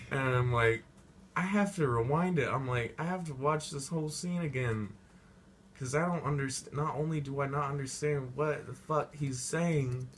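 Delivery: worked-up venting; exasperated rant. Real, not acted. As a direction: vent the confusion. Exasperated rant about confusion.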